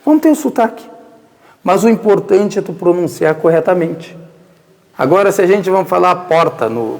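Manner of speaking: ordinary talk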